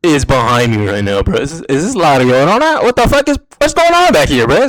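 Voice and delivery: spooked by a noise behind him; startled. Retold or imitated. Alone in the office, hearing noises behind him, startled.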